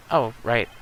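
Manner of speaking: ordinary delivery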